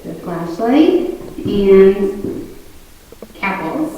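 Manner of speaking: breathless, catching air between words; out of breath. Real, not acted. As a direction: still out of breath, talking. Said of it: "sorry, I'm very out of breath".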